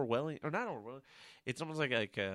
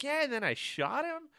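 mock airy Nanny; 9 semitones higher than usual. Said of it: "Nanny Maker's like".

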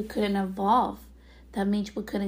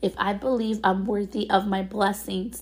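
ordinary talk, nothing marked

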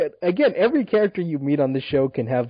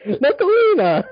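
high-pitched exclamation, half laughing; gleeful high yelp; excited mock shout; 4 dB louder, slower than usual. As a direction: call the name out high with a laugh. Acted line of the show, shouted.

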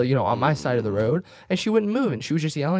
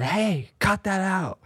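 slow, higher mock yell; retold yell; 6 semitones higher, slower than usual. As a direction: bark it out slowly, as if across the booth. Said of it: "I yelled at the top of my lungs".

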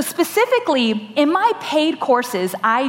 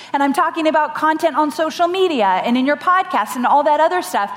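ordinary delivery

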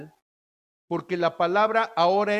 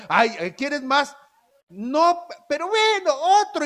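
higher, louder play of offer and giving in; mock party exchange; 8 semitones higher, 4 dB louder than usual. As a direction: hesitate then give in. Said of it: "En una fiesta". (ofrecimiento y recaída en tono burlón)

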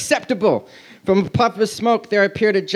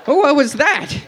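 high, acted yelp of surprise; sudden startled question, pitched up; 7 semitones higher, 3 dB louder than usual. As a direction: jump on it with mock alarm. Startled character in story.

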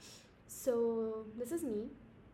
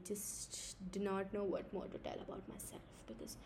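whispering; 7 dB quieter than usual. Real, not acted. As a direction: whisper it, trailing off. Drops to a whisper.

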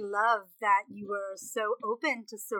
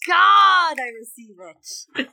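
churchgoer amen; 9 dB louder, much slower than usual. Pretend. Mock church response to host.

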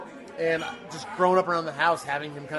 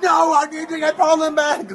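much higher, shouted, laughing mock tantrum; Hitler in the bunker; 12 semitones higher, 9 dB louder than usual. Brother imitating Hitler's bunker meltdown in a hammed-up shout. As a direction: rant like a dictator refusing defeat, half laughing. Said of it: "Hitler in the bunker when they told him".

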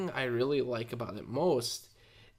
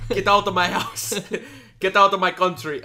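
shouting; 7 semitones higher, 10 dB louder than usual. Pretend. Mock shouting in the accent bit.

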